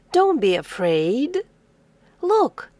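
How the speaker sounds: ordinary talk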